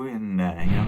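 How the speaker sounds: ordinary talk, nothing marked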